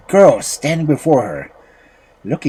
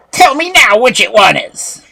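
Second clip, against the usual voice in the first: high, loud witch's demand; 11 semitones higher, 8 dB louder than usual. A narrator's reading. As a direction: demand an answer as an impatient witch. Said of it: "asked the witch".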